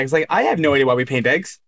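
ordinary talk, nothing marked

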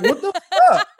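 high, loud shocked outburst; mock outrage. Son retelling his shock at his mom, loudly appalled. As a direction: burst out appalled, voice leaping up. Retold shock at mother's remark.

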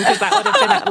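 squeaky laugh breaking into the words; joking that the plane would make a better story, laughing. Real, not acted. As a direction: let the laugh push the pitch way up.

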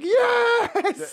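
very high, loud yelled yes; shrieking yes; retold scream. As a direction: scream it from the doorway.

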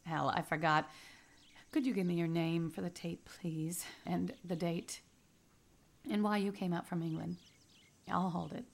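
usual speaking voice

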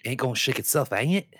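cheeky London bloke, played up; mock British accent. Retrying the line in a Cockney accent. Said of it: "okay, I got it".

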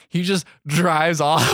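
ordinary delivery

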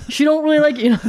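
mock scolding. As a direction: scold the guest half-laughing. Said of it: "And I'm like".

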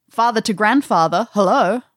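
ordinary talk

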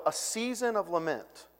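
normal talking voice

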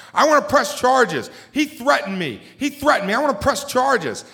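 high, loud whining demand; shrill-free high loud complaint of a caught man; whiny protest. Retold or imitated. Handcuffed man demanding charges from the cops, high loud whine.

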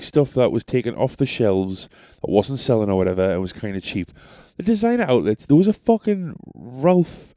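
ordinary talk

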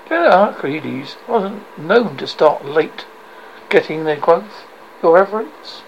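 ordinary delivery

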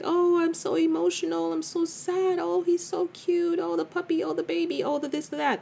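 high, gushy mock-sentimental voice; a high sing-song gush at everything cute; mock weepy self. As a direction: imitate your overly emotional self cooing over puppies. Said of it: "They're just, like, everyday kinda cries".

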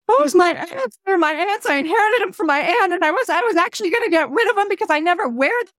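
high, rushed mock-weepy confession; half-played crying, words tumbling out high; imitated tearful woman. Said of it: "And the woman starts crying".

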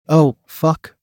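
usual speaking voice

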